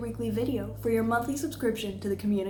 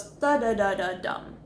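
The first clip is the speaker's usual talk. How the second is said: sung-out syllables stepping down to "dum"; downward inflection. Shown as a demonstration. Coach sounds out the falling pitch pattern, downward inflection. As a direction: land lower on the final "dum". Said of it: "end on that lower voice tone".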